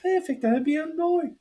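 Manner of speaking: mock Australian accent. Friend taking over the failed Australian line, high. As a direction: squeak out a mock Australian phrase. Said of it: "My mouth does not work with Australian".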